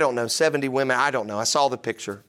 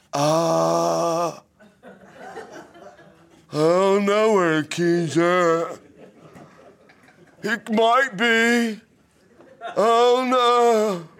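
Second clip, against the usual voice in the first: put-on a teen son's slow, whining excuse, higher and drawn out; whiny son; 4 semitones higher, much slower than usual. Son on the phone to his dad about the lost key, mock whining. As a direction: whimper it like a kid caught out.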